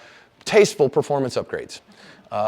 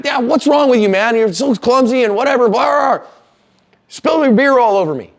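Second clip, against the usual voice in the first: higher, louder mock outrage at the clumsy guy; hammed-up angry bar guy yelling; mock angry rant; 9 semitones higher, 4 dB louder than usual. Acted angry bar patron.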